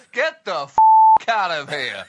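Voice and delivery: hammed-up boss throwing everyone out; mock shout. President dismissing his whole cabinet, mock shouting.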